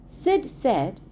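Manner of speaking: plain reading voice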